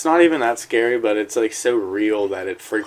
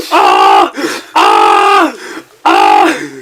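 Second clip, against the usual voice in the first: imitated screaming; 12 semitones lower, 9 dB louder, much slower than usual. Man charged by a bear drops his camera, frantic screaming. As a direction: scream like you're being charged. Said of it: "and he's like".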